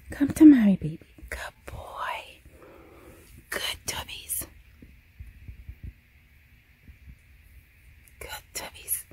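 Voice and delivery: soft whispered coaxing. Real, not acted. Mom coaxing scared dog into closet, whispered. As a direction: breathe it gently to a frightened dog.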